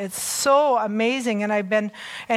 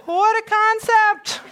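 laughing; 10 semitones higher, 5 dB louder, slower than usual. Real, not acted. Joking aside to congregation.

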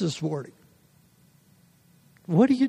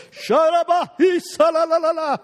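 Pentecostal quiver; 10 semitones higher than usual. Retold or imitated. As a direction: ham up a quivering Pentecostal prayer. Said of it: "that right Pentecostal quiver".

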